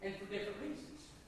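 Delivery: low, soft pastoral voice; quiet altar appeal. Real, not acted. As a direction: bring it right down and invite them gently.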